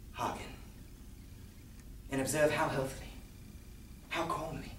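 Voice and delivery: theatrical madman insisting on his sanity; unhinged storyteller, volatile and intense; nervous madman narrator. Acted. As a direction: swing from hushed confiding to wild outbursts. Performs mad narrator's monologue.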